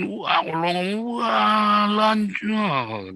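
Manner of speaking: high, long drawn-out mock holler; hammed-up holler, stretched very slowly; imitated ranting boss. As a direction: draw out the words, rising high and loud. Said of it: "kalau sore saya ngomong begitu".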